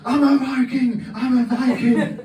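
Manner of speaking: put-on childhood shout. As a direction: yell it like a boy waving an axe. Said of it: "shouting at the top of my lungs".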